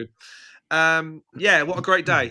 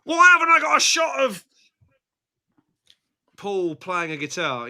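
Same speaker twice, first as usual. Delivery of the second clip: mock exasperated self; 5 semitones higher than usual. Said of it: "Me going".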